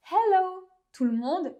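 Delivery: hello high, loud, the English way; a hammed-up English hello at work; over-the-top English greeting; exaggerated English hello. Shown as a demonstration. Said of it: "les gens vont se demander si j'ai un problème".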